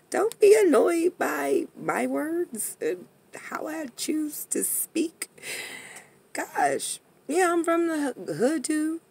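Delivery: whispering. Real, not acted. Sassy whispered aside.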